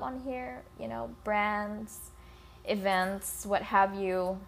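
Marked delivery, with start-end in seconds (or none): none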